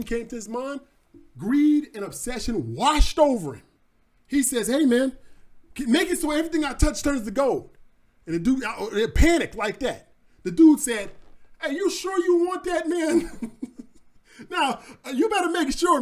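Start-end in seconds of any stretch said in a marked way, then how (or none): 4.7-7.8 eager demand. Acted. Midas makes his greedy wish. 11.6-16.0 chuckling through a warning; laughing warning. Acted. God chuckles warning greedy Midas, laughing.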